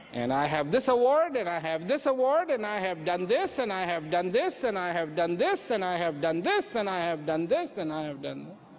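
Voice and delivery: low sing-song list, each item rising at the end; droning boastful list. Acted. Asked who they are, someone lists accomplishments, droning boastfully. Mimics someone endlessly listing achievements.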